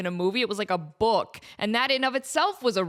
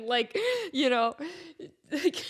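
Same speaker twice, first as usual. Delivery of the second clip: ordinary delivery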